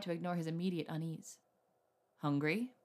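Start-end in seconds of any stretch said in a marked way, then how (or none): 2.2-2.7 low, lightly teasing question; a touch of tease, pitched down. A narrator's reading. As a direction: tease gently with one word. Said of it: "he asked in a teasing tone".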